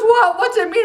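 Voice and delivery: a hint of an excited little girl; eager child. Child eagerly asks back.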